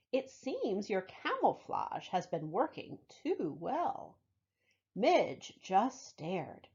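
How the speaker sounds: lively storybook read-aloud; reading to children. Acted. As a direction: tell it warmly to a room of kids. Reads picture book to children.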